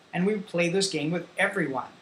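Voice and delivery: ordinary delivery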